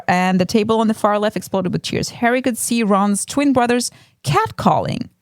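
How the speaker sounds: normal talking voice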